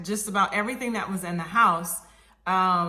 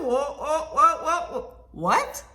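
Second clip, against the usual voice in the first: high, stammered disbelief; interrupting, pitched-up bewilderment; retold alarm; 7 semitones higher, 4 dB louder, much slower than usual. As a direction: sputter in mock shock. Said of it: "I was a little crazy on the phone".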